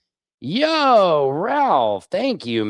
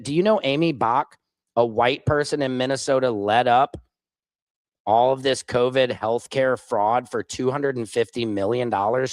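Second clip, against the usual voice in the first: normal talking voice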